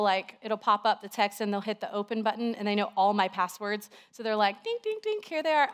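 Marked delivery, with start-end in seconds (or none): 4.6-5.7 high sing-song mimicking of kids tapping a phone; playful kid imitation. Mom mimicking her kids opening her phone, high and playful. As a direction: pipe up like a meddling child.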